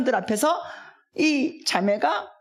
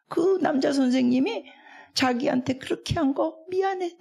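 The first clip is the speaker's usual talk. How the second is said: usual speaking voice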